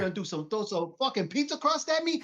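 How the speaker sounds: high, mocking playground threat; Brooklyn kid trash talk. Imitating his childhood crew trading insults, high and mocking. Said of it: "You're like".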